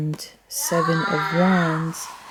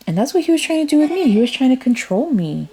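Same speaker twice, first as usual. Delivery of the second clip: high, rapid, louder outburst; indignant; 7 semitones higher, 4 dB louder, much faster than usual. Real, not acted. Reader protesting that he tried to control her, indignant. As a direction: rush it out, pitch jumping up in protest.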